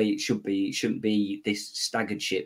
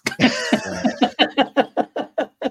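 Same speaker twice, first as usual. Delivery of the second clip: loud, high-pitched laugh; long, loud real laughter; 7 dB louder than usual. Real, not acted. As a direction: burst into a big high laugh. Laughs after quiz options.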